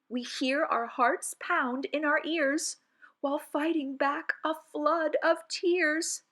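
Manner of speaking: ordinary delivery, nothing marked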